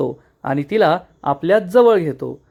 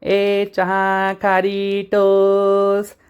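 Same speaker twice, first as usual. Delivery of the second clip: long drawn-out hawker's cry; sing-song vendor chant on a held note; street vendor's call; 2 semitones higher, much slower than usual. Retold or imitated. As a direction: imitate a khari seller calling down the lane, very slow. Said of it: "जो ओरडायचा".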